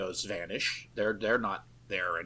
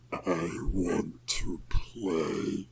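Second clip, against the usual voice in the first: slow unvoiced demon whisper; 5 semitones lower, much slower than usual. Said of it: "we hear the demon".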